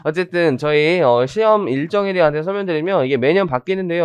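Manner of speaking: ordinary delivery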